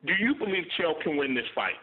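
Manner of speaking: usual speaking voice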